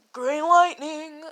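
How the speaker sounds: drawn-out call for a cat; searching call. Acted. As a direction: slowly call the name out, sing it a little. Character calls for the lost cat.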